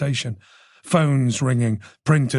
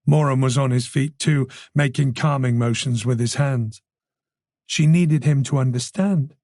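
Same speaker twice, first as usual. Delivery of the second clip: ordinary delivery, nothing marked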